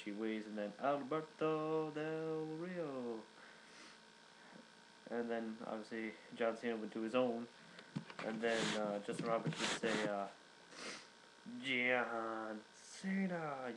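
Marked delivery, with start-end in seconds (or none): 0.8-3.2 high, slow, drawn-out ring announcer call; ring announcer intro. Retold or imitated. Ricardo Rodriguez announcing Del Rio to the crowd, drawn-out high announcer call. 11.6-13.7 very slow, drawn-out announcer name call; ring announcer call. Retold or imitated. Justin Roberts announcing Cena to the crowd, drawn-out announcer call. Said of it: "you know, like that".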